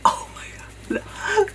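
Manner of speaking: high, very slow whispered awe; awed whisper. Real, not acted. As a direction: breathe it out in wonder. Seeing a feather fan.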